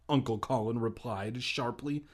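plain reading voice

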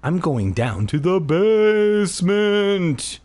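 slow, high, drawn-out menace; slow spooky announcement; creepy Keith. Acted. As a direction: stretch it out eerily. Creepy line repeated.

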